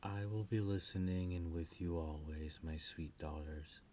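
soft, low loving mother; tender farewell. Acted. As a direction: gently send them off. Queen Selena farewell to daughters.

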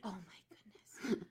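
breathy whispered sympathy. Real, not acted. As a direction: whisper it softly.

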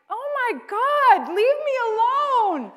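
mock-dramatic plea to be left alone; exasperated inner cry. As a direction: groan it out like you've had enough. Irritated at usher's offer.

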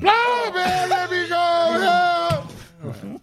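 drawn-out, very high shouted plea; high, slow mock scream to be let go; mock prison plea. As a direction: wail it out in a hammed-up high shout. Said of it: "this is you describing something that might have happened in prison".

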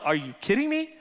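incredulous. Retold or imitated. Husband answering wife who wants a bath first, incredulous. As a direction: pitch it up as a baffled retort. Retells disbelief at wife's bath plan.

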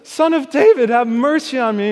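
high, loud desperate cry for mercy. A narrator's reading. Beggar crying to Jesus over the crowd, loud pleading shout. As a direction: cry out with everything you have. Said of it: "He cries out louder".

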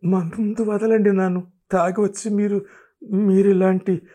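frantic flustered protest; struggling, flustered protest. A narrator's reading. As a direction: push him off while flustered. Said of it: "ఉక్కిరి బిక్కిరవుతూ".

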